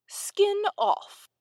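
deadpan cat answer with falling end; dry. A narrator's reading. Cat tells mouse the child's made-up name, dryly. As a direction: state it dryly, as settled fact. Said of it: "the cat said dryly".

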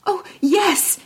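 high, eager princess voice. A narrator's reading. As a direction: agree at once, voice lifted high. Princess eagerly agrees to bargain.

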